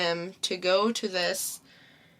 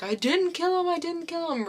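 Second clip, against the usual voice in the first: distraught dad; 9 semitones higher than usual. Retold or imitated. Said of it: "really distraught".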